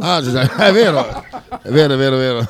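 laughing agreement. Real, not acted. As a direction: laugh through the words.

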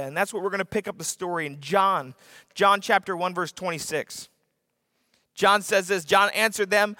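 ordinary delivery

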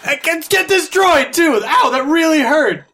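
high-pitched shouting in mock pain; hammed-up cry of indignation and hurt; pained yelp. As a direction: yelp like someone just zapped. Trooper cries out, shocked by droid.

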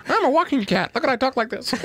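put-on high pinched kid voice, laughing. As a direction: squeeze out a tiny cartoon kid. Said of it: "I remember that voice".

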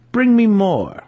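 high, loud order; cruel command. Acted. Wizard commanding the panther to hunt, cruel loud order. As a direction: bark the order at the cat.

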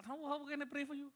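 usual speaking voice

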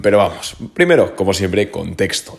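ordinary delivery

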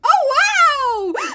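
high, loud hammed-up surprise; mock amazement. Jo hearing about Laurie's marriage, mock amazement. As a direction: blurt it high and loud, overdoing the shock. Acting out stunned reaction to news.

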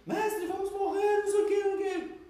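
high, loud panicked cry; panicked disciples. Retold or imitated. Disciples yelling at sleeping Jesus in panic. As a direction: shriek that the boat is sinking.

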